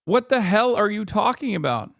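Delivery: normal talking voice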